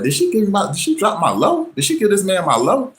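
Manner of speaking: high, incredulous rising questions; can't believe she gave him away; shocked disbelief. Retold or imitated. Realizing ex gave his address.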